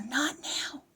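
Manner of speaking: breathy playful whisper slipped in mid-sentence; whispered aside. Real, not acted. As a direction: drop to a quick secret whisper. Playful aside while giving instructions.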